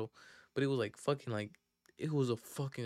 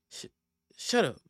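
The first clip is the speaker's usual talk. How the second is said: stammered, louder and higher retort; mock stutter; 6 semitones higher, 6 dB louder than usual. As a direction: catch on the first sound, then burst out. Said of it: "With that, like, same little stutter".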